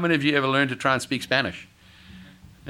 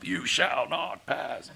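slow, drawn-out wizard's command, pitched up; Gandalf; 3 semitones higher, 5 dB quieter, much slower than usual. Acted. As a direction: stretch each word into a grand barring of the way. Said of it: "Pull a Gandalf".

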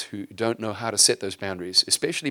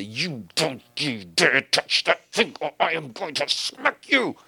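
slow, higher mock-angry scolding; stern threatening parent; 6 semitones higher, 3 dB louder, slower than usual. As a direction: play the harsh parent who threatens a child. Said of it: "he doesn't do it in a stiff-armed way".

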